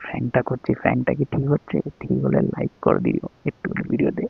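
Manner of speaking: low, very soft confiding voice; secretive hush. Real, not acted. Husband secretly telling viewers about the gift for his wife, hushed. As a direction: murmur to the camera so she can't hear.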